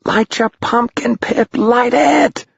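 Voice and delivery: put-on very high, frantic boy's shriek. A narrator's reading. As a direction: shriek a desperate order, drawing out the end. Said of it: "shrieked Tom".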